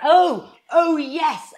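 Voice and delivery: loud, high "oh" of sudden remembering; flustered mock recollection. Liar caught out by a friend's question, flustered and overloud. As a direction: cover up being caught out, far too emphatically.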